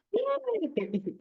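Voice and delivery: high, drawn-out little cheer. Pretend. Teacher cheering the end of the story, high. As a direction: squeal a light hooray.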